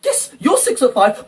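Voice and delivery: ordinary delivery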